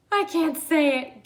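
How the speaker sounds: high, loud giggly voice; giggling. Acted. Rhinoceros giggles, unable to say his name, high and loud. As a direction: giggle through the words. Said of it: "giggled the rhinoceros".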